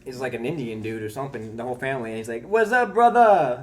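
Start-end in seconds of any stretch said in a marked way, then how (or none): 2.5-3.6 drawn-out, much higher, louder friendly greeting; imitating the store owner. Store owner greets the narrator as a buddy, hammed-up high call. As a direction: stretch out a loud, high hello to an old pal. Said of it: "That's how he always talks to me".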